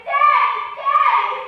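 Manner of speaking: put-on high, loud child's cry; screaming. A narrator's reading. Little girl runs to her daddy, screaming. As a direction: scream out for a parent.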